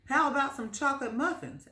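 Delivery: high, fast chirpy baker; cheerful baker. Acted. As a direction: sell the muffins brightly. Baker voiced.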